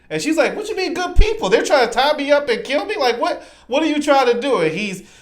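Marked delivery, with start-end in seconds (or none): 0.5-4.6 very high, louder mock panic; high-pitched, indignant daughter impression; alarmed daughter. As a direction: imitate a girl protesting in alarm. Voices alarmed daughter arguing with father.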